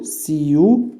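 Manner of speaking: سي او ثري said very slowly, letter by letter; teacher dictating a formula, very slow; slow dictation. Shown as a demonstration. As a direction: spell it out slowly for note-taking. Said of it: "يبقى اقول له".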